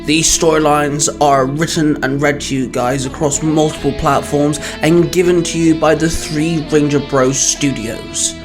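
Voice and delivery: ordinary delivery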